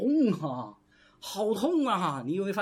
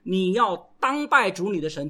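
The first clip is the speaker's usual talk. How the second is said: ordinary talk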